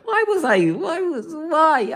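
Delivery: soft start rising into high, pleading whys; regretful self-questioning. Acted. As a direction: wail it lightly, mock self-reproach. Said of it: "you'll realize".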